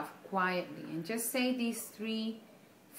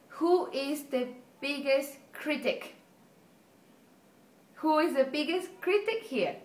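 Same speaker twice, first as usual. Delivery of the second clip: ordinary talk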